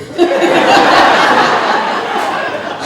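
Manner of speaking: high, loud unvoiced cackle; burst of laughter. Real, not acted. Storyteller cracks up at his own punchline, laughing loud. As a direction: laugh helplessly at the absurdity.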